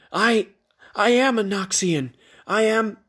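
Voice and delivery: high, halting protest; faltering protest. A narrator's reading. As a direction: try to argue, words failing. Faltering attempt to argue back.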